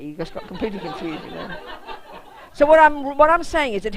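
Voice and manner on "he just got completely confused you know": laughing. Real, not acted. Laughing at the memory.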